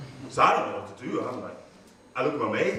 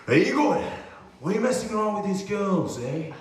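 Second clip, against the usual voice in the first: acting tough; 6 semitones higher than usual. Kid confronting Igor in the schoolyard, mock tough. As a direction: puff up and call him out. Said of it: "acting, like, all kind of tough".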